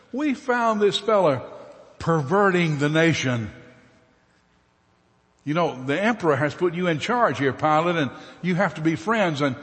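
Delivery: high, loud denunciation; accusing. Acted. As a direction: whip up the governor against him. Accusers before Pilate.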